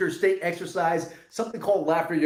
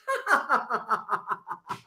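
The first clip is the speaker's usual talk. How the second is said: falling laugh; 4 semitones higher than usual. Shown as a demonstration. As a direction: laugh sliding down in pitch. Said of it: "laugh on the way down".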